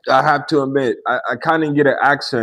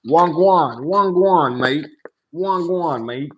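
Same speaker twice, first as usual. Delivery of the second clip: put-on higher, playful London street accent greeting; London slang greeting; 4 semitones higher, slower than usual. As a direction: greet him like a London roadman, pitched up. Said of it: "I shoulda said".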